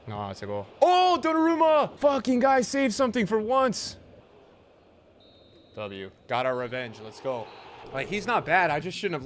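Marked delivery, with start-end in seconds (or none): 0.8-4.0 high, loud cry of surprise at the save; sudden high-pitched cheer; excited shout. Real, not acted. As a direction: yell the keeper's name in delight. Keeper makes a save.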